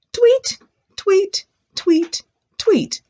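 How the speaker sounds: four very high imitated tweets; high, short birdie peeps. Shown as a demonstration. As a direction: chirp four times like little birds. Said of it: "the birds say".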